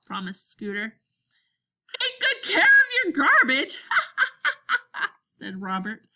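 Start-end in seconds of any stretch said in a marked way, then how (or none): 2.0-3.8 high, loud, teasing call; laughing taunt. A narrator's reading. Robert taunts Henry as friends leave, laughing taunt. 3.8-5.1 high, rapid cackle; taunting laugh. A narrator's reading. Robert leaving Henry with a jibe, taunting laugh.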